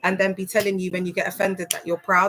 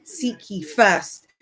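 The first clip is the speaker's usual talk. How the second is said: seek ye first drawn out slowly, weight on first; slow stress on first; much slower than usual. Shown as a demonstration. Demonstrating how the verse gets stretched slowly. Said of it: "he's put in the proton".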